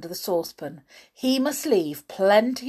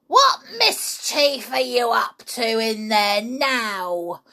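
screeching grandma; 2 semitones higher than usual. Acted. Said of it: "Granny screeched".

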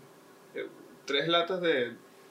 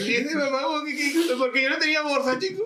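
excited retelling; 11 semitones higher, 4 dB louder than usual. Retold or imitated.

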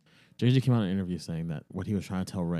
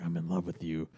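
soft, lower, intimate love line; tender confession; 4 semitones lower, 7 dB quieter than usual. Retold or imitated. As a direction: gaze into her eyes and murmur it. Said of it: "Kylo looking ... into Rey's eyes, like".

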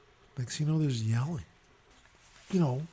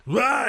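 loud, high mock roar; mock angry yell; 11 semitones higher, 7 dB louder than usual. Angry guest in the street, mock yell. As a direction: burst out like an angry drunk, playfully.

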